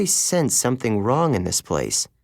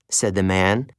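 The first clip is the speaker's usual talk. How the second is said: ordinary delivery, nothing marked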